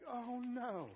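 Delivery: high-pitched wail of alarm; tiny trapped figure's drawn-out cry; mock dismay. Voices the trapped little globe person.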